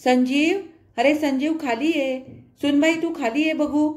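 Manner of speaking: mother calling son and daughter-in-law down, loud call. A narrator's reading. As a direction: call him down from below. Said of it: "तिने हाक मारली".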